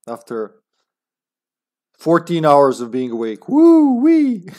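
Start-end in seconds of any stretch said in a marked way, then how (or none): 3.5-4.5 high, loud woo-wee holler; excited whoop. Real, not acted. Gamer whooping after hours of being awake, excited.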